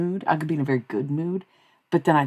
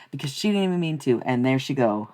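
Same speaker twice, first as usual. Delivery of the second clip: ordinary talk, nothing marked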